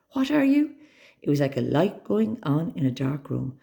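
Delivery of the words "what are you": frantic. A narrator's reading. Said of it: "I said frantically".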